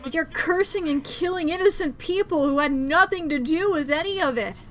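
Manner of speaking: loud, indignant rant; heated, forceful telling-off; angry scolding. Acted. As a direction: shout the ghosts down. Character scolds the killer ghosts.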